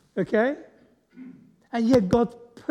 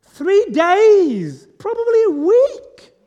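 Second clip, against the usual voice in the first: exclaimed answer; 10 semitones higher, 10 dB louder, much slower than usual. Real, not acted. Answering his own guess question.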